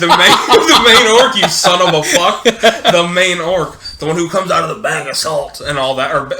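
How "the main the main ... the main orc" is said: loud, laughing protest; laughing insistence. Real, not acted. Friend defends his orc answer, loud and laughing.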